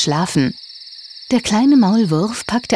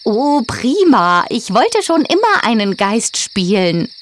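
delighted; 5 semitones higher than usual. A narrator's reading. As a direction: cheer it out with joy. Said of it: "rief die Vogelscheuche begeistert".

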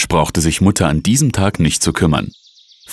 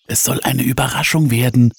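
ordinary delivery

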